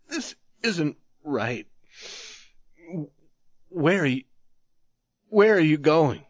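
a hint of tremble, pleading; shaky, lightly voiced pleading; trembling voice. A narrator's reading. Wounded man pleads with the youth, lightly trembling. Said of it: "speaking in a trembling voice".

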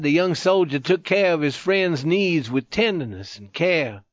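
ordinary delivery, nothing marked

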